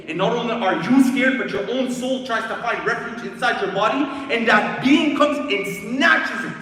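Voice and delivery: loud, high, alarmed telling; intense dramatic preaching. Real, not acted. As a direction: raise the voice as the horror closes in. Terror of soul being seized.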